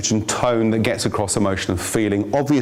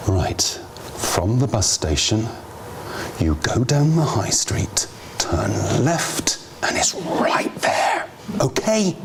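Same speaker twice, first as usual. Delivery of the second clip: bored, dismissive directions; slower than usual. Shown as a demonstration. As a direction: mumble it like the asker is a nuisance. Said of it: "the first version is not gonna get them anywhere near".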